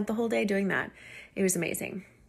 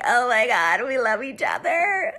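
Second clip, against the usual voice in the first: mock tearful gushing, raised voice; cheesy emotional; 3 semitones higher, 4 dB louder than usual. Friends tearing up in the car together, mock-emotional. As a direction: ham up a weepy group love-in. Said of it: "cheesy, emotional moment".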